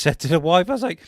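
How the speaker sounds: ordinary talk, nothing marked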